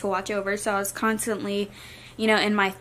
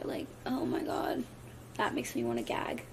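whispered disgust; 12 dB quieter than usual. Real, not acted. Disgust at the nursery song.

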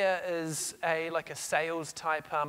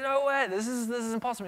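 incredulous; 7 semitones higher, 4 dB louder than usual. Retold or imitated. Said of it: "Craig's like".

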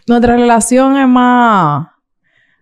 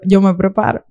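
normal talking voice

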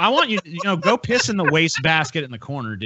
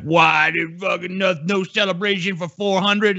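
whiny fan quote; 6 semitones higher, slower than usual. Retold or imitated. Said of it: "a bunch of people were like".

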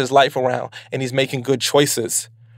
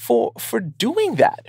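gushing thanks; 12 semitones higher, 3 dB quieter than usual. Retold or imitated. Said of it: "They're gonna be like".

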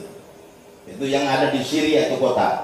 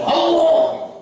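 Allah shouted high and loud; loud Aleppo-style Allah. Shown as a demonstration. Preacher shows how Aleppo people call Allah, loud and high. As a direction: belt out Allah with full force. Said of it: "banter kenceng".

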